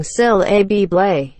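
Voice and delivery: SYLLABLE stressed as "sil-LAB-le"; wrong-syllable stress. Shown as a demonstration. Teasing wife with misplaced emphasis, wrong-syllable stress. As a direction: shift the stress to the middle of syllable. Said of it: "put the emphasis on the wrong".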